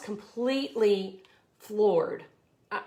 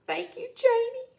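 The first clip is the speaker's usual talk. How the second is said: high, moved thank you; touched thanks; 14 semitones higher than usual. Real, not acted. Thanking viewer Janie, high and moved. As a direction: thank her like it catches in your throat.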